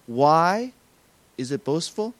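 ordinary talk

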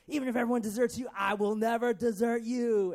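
loud, high-pitched vow; emphatic declaration. Acted. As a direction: declare it boldly, punching out each word. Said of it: "And Peter declared".

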